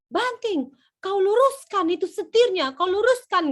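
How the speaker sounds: high, loud commands, sounding furious but isn't; Medan father shouting. Retold or imitated. Father directing his son into the garage, high loud shout. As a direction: boom the orders at the boy. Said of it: "kayak marah-marah".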